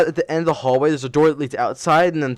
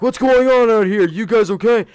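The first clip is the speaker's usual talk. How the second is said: high, loud imitation of teachers calling out; mock-concerned teacher shout; alarmed teachers; 6 semitones higher, 4 dB louder than usual. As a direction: call out like a startled teacher checking on students. Retold teachers rushing out, calling.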